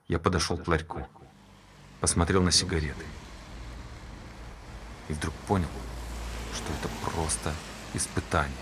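low, soft, hushed telling; almost at a whisper, drawing listeners in; hushed confession. Acted. Hushed climax of the story. (тихо, приглушённо, почти шёпотом)

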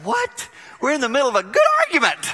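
high, fast startled squeal; shocked wife. Retold or imitated. Wife stunned at husband's sweetness, high squeal. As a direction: shriek in mock disbelief. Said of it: "she'd be like".

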